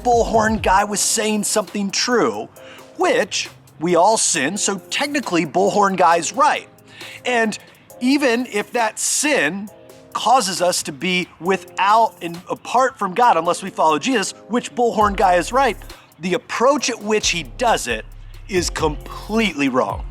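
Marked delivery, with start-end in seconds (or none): none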